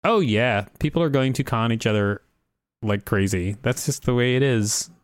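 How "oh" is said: usual speaking voice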